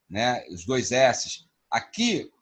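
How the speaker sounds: normal talking voice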